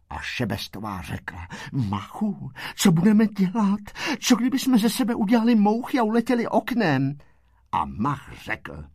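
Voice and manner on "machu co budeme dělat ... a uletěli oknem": high, hurried suggestion; panicky plea. A narrator's reading. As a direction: blurt out an escape plan. Šebestová v panice navrhuje útěk. (vyděšeně, rychle a vysoko)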